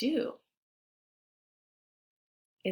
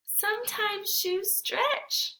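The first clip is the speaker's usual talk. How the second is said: put-on hopeful boy; 13 semitones higher, slower than usual. Jeremy tells Grandma the shoes might stretch, hopeful and slow. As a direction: wish the shoes will grow.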